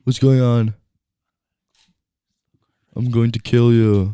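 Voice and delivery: throaty action-star impression, measured; Arnold. Co-host threatening host while demonstrating, mock-menacing impression. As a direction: deliver a slow mock threat as the Austrian strongman. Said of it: "how to do an Arnold voice".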